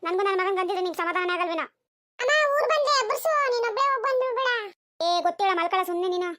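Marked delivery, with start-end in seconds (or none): 2.2-4.5 put-on high, insistent kid voice; child's voice. Child tells mother to wake them on arrival, high child voice. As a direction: plead like a small child, pitched well up.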